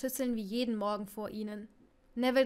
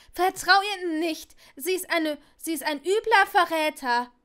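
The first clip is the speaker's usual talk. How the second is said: frantic denial; 5 semitones higher, 9 dB louder than usual. Acted. Neville lenkt panisch ab.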